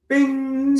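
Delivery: high, bright imitated chime; a high voiced ding of an answer; mock bing sound. Shown as a demonstration. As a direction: sing out a quick bing like a bell. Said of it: "and you say this".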